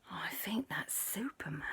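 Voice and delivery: hushed whisper; awed whisper. Acted. Onlookers spot Superman in disguise, whispering. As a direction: whisper it so he won't overhear.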